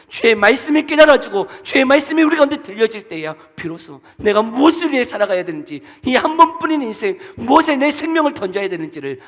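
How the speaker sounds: loud, high preaching climax; fervent preaching. Real, not acted. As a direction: drive the point home to the congregation. Preaching at full force. (열정적으로 외치는 설교)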